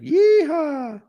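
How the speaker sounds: high, drawn-out yeehaw; mock cowboy whoop. Cheering as the dummies fall, mock whoop.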